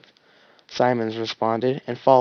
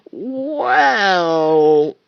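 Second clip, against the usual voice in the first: slow start; 7 semitones higher, 7 dB louder than usual. Acted. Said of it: "Caboose began slowly".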